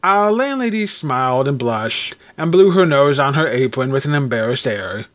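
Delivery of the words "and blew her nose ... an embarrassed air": plain reading voice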